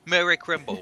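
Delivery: thick British accent, imitating a friend. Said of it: "this really thick British accent".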